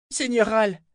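high, loud call to a lord; excited cry. A narrator's reading. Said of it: "s'écria le jeune homme".

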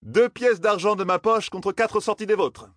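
slow, very high-pitched challenge; high, sustained call. A narrator's reading. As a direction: announce the wager to all, drawn out high. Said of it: "leur lança-t-il".

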